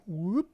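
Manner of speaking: quick rising whoop; upward-sweeping sound effect; playful whoop. Pretend. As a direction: slide up on a little whoop. Voicing the image popping in.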